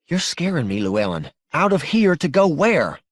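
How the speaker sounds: anxious, pitch jumping high; frightened wife. Acted. Wife told by husband to flee the house, alarmed. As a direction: protest in a high, worried voice.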